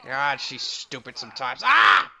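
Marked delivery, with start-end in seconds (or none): 1.6-2.1 startled yelp. Real, not acted. Startled by enemy.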